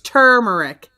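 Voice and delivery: turmeric said TER-mer-ick, stressed first syllable; turmeric with a punched "TUR"; R pronounced. Shown as a demonstration. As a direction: copy the assistant's turmeric, both Rs sounded. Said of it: "So it's turmeric".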